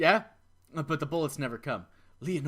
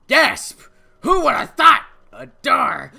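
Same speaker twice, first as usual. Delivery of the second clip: loud gasp and shout of surprise; hammed-up amazement at a door; mock surprise; 6 semitones higher, 13 dB louder, slower than usual.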